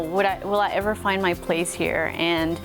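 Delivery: ordinary talk, nothing marked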